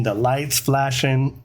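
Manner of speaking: ordinary delivery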